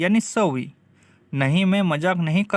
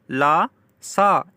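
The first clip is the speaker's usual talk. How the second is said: slow repeat; 4 dB louder, much slower than usual. Shown as a demonstration. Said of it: "slow teaching repeat".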